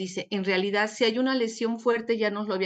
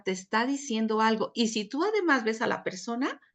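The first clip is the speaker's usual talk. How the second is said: normal talking voice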